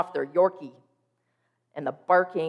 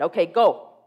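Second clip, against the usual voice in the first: louder release call to the dog; releasing the dog, called out louder; 9 dB louder than usual. Retold or imitated. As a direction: call out to send her off.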